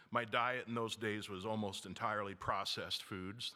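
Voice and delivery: usual speaking voice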